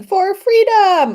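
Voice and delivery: drawn-out high, loud battle cry; mock battle cry. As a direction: shout it like a charging rebel, slow. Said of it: "charging around and yelling".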